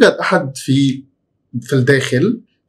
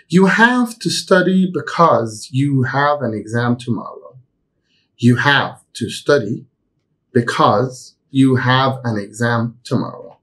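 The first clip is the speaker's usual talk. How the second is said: slow, careful example reading; slow model sentence; much slower than usual. Shown as a demonstration. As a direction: read it slowly for students to follow. Teacher reads example slowly. (جملة مثال ببطء ووضوح)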